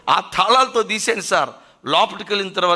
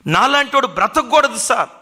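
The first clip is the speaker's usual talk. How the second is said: crying plea; 7 semitones higher, 5 dB louder than usual. Acted. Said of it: "అని వెక్కి వెక్కి ఏడుస్తున్నాడట".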